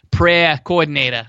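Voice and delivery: "prayer coordinator" as Boston "pray-ah co-ahdinatah"; Boston accent. Acted. Teasing a friend about his church calling in a Boston accent. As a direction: drop every R, Boston-style. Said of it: "episode in our Boston accents".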